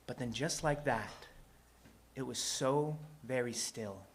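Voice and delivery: suddenly soft storytelling; quiet, suspenseful narration; hushed stillness. A narrator's reading. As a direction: drop to a soft, careful hush. Sudden stillness after the climax.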